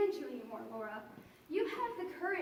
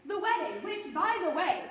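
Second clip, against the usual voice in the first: ordinary talk, nothing marked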